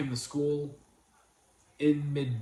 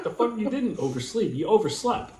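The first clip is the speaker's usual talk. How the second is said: loud high exasperated rant; indignant outburst; 10 semitones higher, 10 dB louder than usual. Retold or imitated. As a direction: blow up in disbelief. Retort to daughter, in his head.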